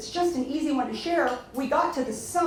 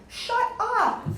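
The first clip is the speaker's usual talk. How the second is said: a sharp, much higher "shut up" in disbelief; stunned disbelief; 12 semitones higher than usual. Retold or imitated. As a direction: react like you can't believe your ears. Stunned at the CEO's call.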